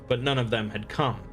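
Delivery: ordinary delivery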